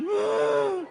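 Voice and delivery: high sharp gasp; pained gasp. Retold or imitated. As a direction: gasp like a sick kid. Boy crying in bathroom.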